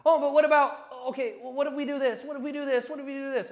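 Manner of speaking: high, loud, laughing mockery of a flailing seller; mocking a desperate salesperson, high and frantic; desperate idiot salesman. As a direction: squeak out panicked offers like a fool. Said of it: "they sound like idiots".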